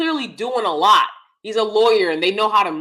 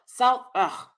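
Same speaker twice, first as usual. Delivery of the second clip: ordinary talk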